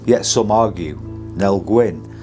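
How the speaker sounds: measured, ominous ghost-show voiceover; documentary narration. A narrator's reading. Spooky documentary voiceover over music.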